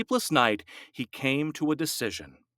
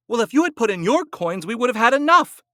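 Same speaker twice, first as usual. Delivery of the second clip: fast, loud, higher snap; snapped; 9 semitones higher, 6 dB louder, faster than usual. A narrator's reading. Wife blaming her husband, fast angry snap. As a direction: bite the words off at him. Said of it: "snapped Gittel".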